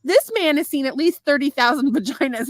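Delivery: normal talking voice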